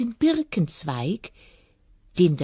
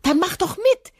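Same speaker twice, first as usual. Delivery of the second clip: very high, loud cheerful rabbit call; laughing rabbit; 6 dB louder than usual. Acted. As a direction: invite him brightly. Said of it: "lachte der Hase". (hohe, fröhliche Hasenstimme)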